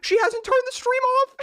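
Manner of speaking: high, slow, incredulous; stunned disbelief. Real, not acted. Watching her rant still live, high stunned disbelief.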